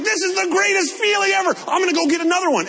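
high, fast, thrilled cry; thrilled shout. Retold or imitated. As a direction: gush like you just won. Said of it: "She was like".

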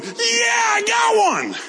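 high joyful shout; excited shout. Retold or imitated. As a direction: whoop it out down the hallway. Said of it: "she started running down the hallway going".

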